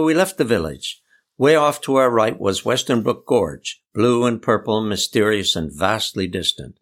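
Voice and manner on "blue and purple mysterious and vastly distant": ordinary delivery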